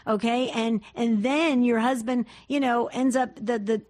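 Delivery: normal talking voice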